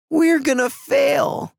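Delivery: high, drawn-out tearful wail. A narrator's reading. Eva to her brother as the camera is taken, tearful wail. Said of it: "she sniffed".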